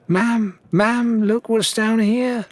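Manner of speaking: put-on high, eager child's call to her mother; excited girl calling. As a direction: call up excitedly from the hole below, slow. Daughter calling from sinkhole.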